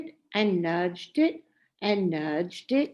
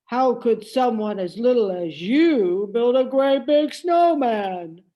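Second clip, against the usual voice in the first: blustery voice; 6 dB louder than usual. A narrator's reading. Big Lizzie questions Little Nell.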